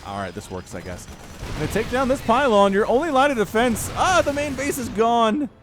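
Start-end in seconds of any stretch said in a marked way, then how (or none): 1.6-5.5 loud, high-pitched caster excitement; excited play-by-play. Real, not acted. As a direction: call the attack at full volume as it happens. Casting the base's collapse live.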